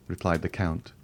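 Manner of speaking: plain reading voice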